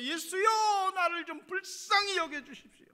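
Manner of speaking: desperate beggar shouting for mercy; crying out. Retold or imitated. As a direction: beg Jesus at the top of your voice. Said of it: "소리 질렀습니다".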